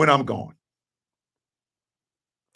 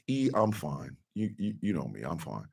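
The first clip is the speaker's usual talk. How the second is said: very soft, low acted brush-off; quiet mock reassurance; subdued reply; 13 semitones lower, 17 dB quieter than usual. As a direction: play it down in a hushed, low voice. Acting his own subdued reply to friend.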